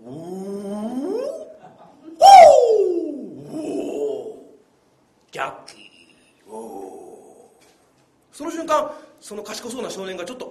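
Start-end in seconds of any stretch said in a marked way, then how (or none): 0.0-7.6 kung fu shout. Pretend. Insisting he is Jackie.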